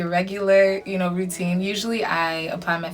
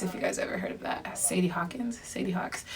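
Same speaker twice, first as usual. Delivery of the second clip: whispering; 8 dB quieter than usual. Real, not acted. Whispering aside about the show.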